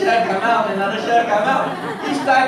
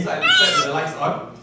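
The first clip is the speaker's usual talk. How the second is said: laughing punchline. Real, not acted. Punchline about being scared.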